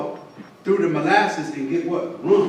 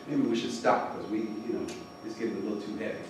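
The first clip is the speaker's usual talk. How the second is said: low, hushed, backing off; quietly feigned hesitation; mock reluctance; 7 semitones lower, 12 dB quieter, faster than usual. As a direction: drop to a soft, low murmur as if wanting to stop. Feigned wish to stop the talk.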